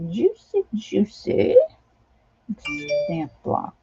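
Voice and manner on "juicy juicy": high, stretched-out juicy, savouring the word; Mary's juicy. Retold or imitated. Imitating her friend Mary while picking a stamp, high and stretched. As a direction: mimic a friend's drawn-out juicy. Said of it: "as Mary would say".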